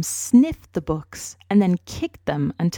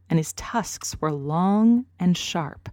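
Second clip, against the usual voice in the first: usual speaking voice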